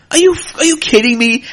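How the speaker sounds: can't believe it, let it burst out; incredulous. Real, not acted. Podcaster reacting to a troll's comment, loud disbelief.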